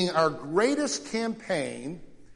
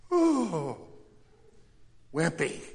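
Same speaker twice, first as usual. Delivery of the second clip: mock awe; slower than usual. Mocking fear of a boss.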